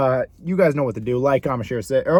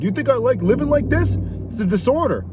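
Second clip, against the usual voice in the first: higher, defensive friend pleading his case; mock the friend's excuse, a bit higher; whiny friend; 7 semitones higher than usual. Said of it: "He used to be like".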